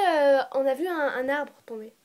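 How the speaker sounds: ordinary delivery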